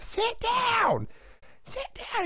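put-on shrill high old lady yelling; mock an angry grandma telling him off. Grandma at a graduation yelling at a guy, high mock shout. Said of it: "some grandma with a cane, was pissed off".

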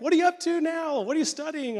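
high, loud, enthusiastic questions; hammed-up excited curiosity; eager questioning. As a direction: imitate a coach keen to catch up. Coach eagerly asks for news.

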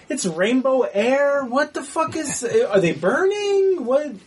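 high, slow mock bewilderment; bewildered. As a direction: puzzle aloud with a laugh, questions drawn out. Retelling mounting confusion at the film.

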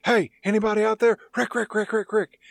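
acted call for help with mimicked radio crackle; radio distress call. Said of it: "on the radio calling for help".

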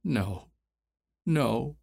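soft, tearful no, no; tearful mutter. Acted. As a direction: beg weakly through tears. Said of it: "muttered through the tears".

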